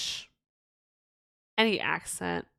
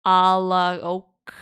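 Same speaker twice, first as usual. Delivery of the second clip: slow reveal; 7 dB louder, much slower than usual. Shown as a demonstration. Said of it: "I just flipped it backwards".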